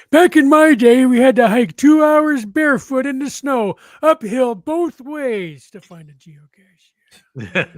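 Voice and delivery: put-on grumpy old man, high and loud, slowing down; crotchety grandpa telling how hard it was. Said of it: "Wits End in your old man voice?".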